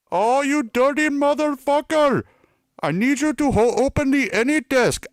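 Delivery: very high, loud put-on Indian scammer voice; Indian accent. Fake Microsoft technician demanding gift cards, high loud Indian accent. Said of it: "apparently decent at sounding Indian".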